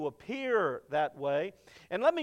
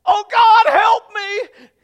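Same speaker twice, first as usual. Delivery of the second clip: much higher, much louder panicked scream; terrified cry for help; 19 dB louder, slower than usual. Retold or imitated. Atheist chased by bear screams to God in panic. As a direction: shriek it out as the bear closes in. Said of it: "and the guy's like".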